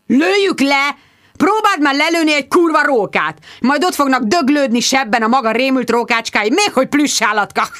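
high, loud mocking outrage; furious scoffing. A narrator's reading. Sir Hugo mocks the idea of shooting foxes, furious. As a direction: explode with scornful indignation. Squire explodes in quarrel over foxes.

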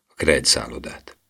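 ordinary delivery, nothing marked

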